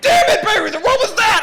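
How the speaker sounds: high, loud imitation of an outraged friend; pained yelling. Friend complaining after grabbing thorns, mock pained shout. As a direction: yell it high, as if just stabbed.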